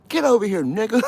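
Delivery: high, loud barking guard; mock guard yell. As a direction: bellow like a prison guard. Guard yelling at inmate.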